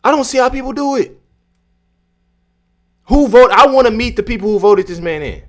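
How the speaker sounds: high, loud, stammering disbelief; incredulous exasperation. Real, not acted. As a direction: stumble over words in loud, baffled outrage. Incredulous reaction to senator's clip.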